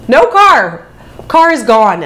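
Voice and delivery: loud, high exclamation; retold shock at a missing car; punched-out disbelief; shocked discovery. Discovers the car has vanished.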